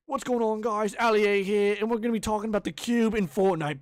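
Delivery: loud, high hyped YouTuber intro; Ali A. Retold or imitated. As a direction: belt out an over-excited gamer channel greeting. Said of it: "people make fun of Ali A".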